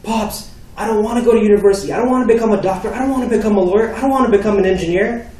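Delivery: high, loud, pleading outburst; acted-out teenage shouting match; screaming back. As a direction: build a frustrated tirade at dad. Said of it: "I'm screaming back at him".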